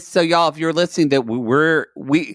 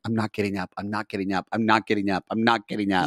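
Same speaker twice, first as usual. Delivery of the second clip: low, much softer, repeated mumble; sleepy quiet refusal; 7 semitones lower, 7 dB quieter, faster than usual. Retold or imitated. Lying in bed, refusing to get up: soft low mutter. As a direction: murmur it into the pillow, refusing to move. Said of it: "I'm laying in bed going".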